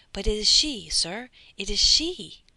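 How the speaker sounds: loud, urgent recognition, slowing; agitated cry. A narrator's reading. Bertuccio urging the count, loud and agitated. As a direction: insist she is the one, loudly.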